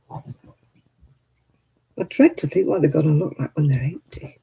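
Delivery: slow hushed whisper, as if letting you in on a secret. Real, not acted. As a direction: drop to a whisper for the gossip. Gossiping aside about cosmetic procedures.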